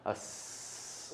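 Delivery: a long unvoiced snake hiss. Shown as a demonstration. Voice teacher showing the class a snake hiss. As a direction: let the air out as a steady hiss. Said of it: "go on a hiss sound".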